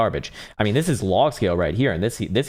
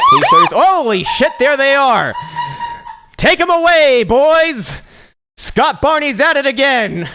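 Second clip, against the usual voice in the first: much higher, loud hype yell; mock cheering; 9 dB louder, slower than usual. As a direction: cheer like an announcer at a pump. Cheering the shitcoin reveal.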